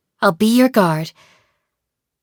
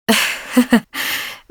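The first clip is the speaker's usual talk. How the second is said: light, airy chuckle; amused chuckle; 3 semitones higher than usual. Acted. Guardian chuckling at a remark on what is edible, amused. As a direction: chuckle before answering.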